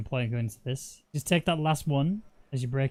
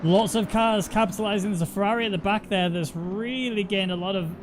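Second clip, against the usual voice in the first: higher, louder race commentary; excited play-by-play; 6 semitones higher, 3 dB louder than usual. Acted. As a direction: call the action as it unfolds.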